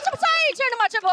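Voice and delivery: high, loud mock astonishment; mock surprise. Comedian told rumor about herself, mock surprise.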